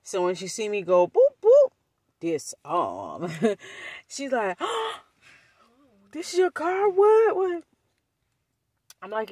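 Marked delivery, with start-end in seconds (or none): 1.1-1.7 very high, loud "boop boop"; imitated car beep. Storyteller shows cousin the car unlocking, high loud beeps. 4.6-7.6 much higher, gasped disbelief; mock astonishment. Cousin sees the new car, high mock shock.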